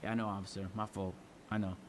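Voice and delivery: telling the officer what he wants to hear, submissive; meek, resigned reply. Retold or imitated. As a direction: swallow your pride and agree. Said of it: "so I say".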